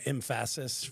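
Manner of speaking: emphasis said em-FASS-iss, drawn out; emphasis mis-stressed as em-FASS-iss; wrong emphasis. Shown as a demonstration. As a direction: stress the middle syllable of emphasis. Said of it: "say words with the wrong emphasis".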